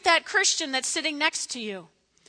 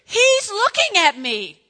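put-on slow, loud, higher kid's yell; 8 semitones higher, 10 dB louder than usual. Child yelling at the breakfast table about a sibling. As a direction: yell it like a kid tattling at breakfast. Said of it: "I hear some kid going".

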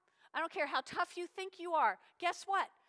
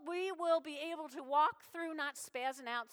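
normal talking voice

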